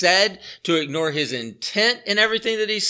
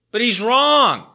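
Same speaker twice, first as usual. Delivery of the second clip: loud, slow, higher and hammered home; emphatic declaration; 6 semitones higher, 6 dB louder, slower than usual. Real, not acted. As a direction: plant your feet and declare it. Preacher insists Peter is wrong.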